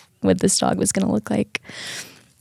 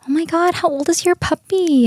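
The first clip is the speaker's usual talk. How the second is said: high-pitched cooing over a puppy; gushing neighbor; 10 semitones higher than usual. Retold or imitated. Neighbor stops a dog walker to admire the puppy, high gushing. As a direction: imitate an excited dog-admirer.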